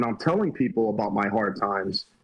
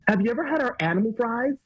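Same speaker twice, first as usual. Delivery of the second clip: high-pitched, bubbly imitation of a server; bubbly counter girl; 10 semitones higher than usual. Fast-food girl tempting a visitor with animal fries, bubbly and high. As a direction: pitch a menu item in a much higher, perky voice. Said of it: "And she goes".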